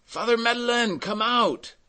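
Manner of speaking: very high, loud shout. A narrator's reading. Bystanders to Madeleine under the cart, shouting. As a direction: call him out, pitched right up. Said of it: "They shouted to him".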